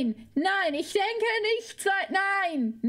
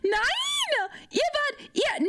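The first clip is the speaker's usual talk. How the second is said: high squealed no; mock protest; 9 semitones higher, much slower than usual. Protests chat's teasing. (hoch gequiekter Protest)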